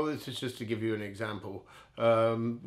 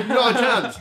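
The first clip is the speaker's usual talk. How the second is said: emphatic laughing no; 12 semitones higher, 13 dB louder than usual. Real, not acted. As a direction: burst out higher and louder, laughing. Laughing disbelief at own past.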